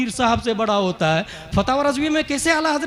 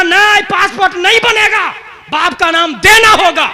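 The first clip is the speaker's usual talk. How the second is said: insistent officer; 8 semitones higher, 9 dB louder than usual. Retold or imitated. Acts officer's insistent refusal.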